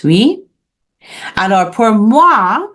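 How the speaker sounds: normal talking voice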